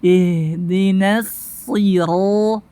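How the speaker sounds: slow recited IHDINA SIRO with a heavy RO; thick ra. Shown as a demonstration. Teacher leading practice, slow recitation with thick ra. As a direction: stretch SIRO on a dark, thick R. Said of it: "ro tebal".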